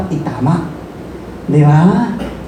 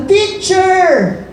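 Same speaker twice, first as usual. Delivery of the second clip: drawn-out, higher-pitched call for a teacher; mock call, pitched up; imitated calling out; 8 semitones higher than usual. Quoting people calling out to Jesus.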